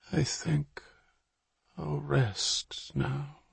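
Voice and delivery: faint, very slow whisper; murmured whisper. Acted. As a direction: breathe it out, fading away. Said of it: "he murmured".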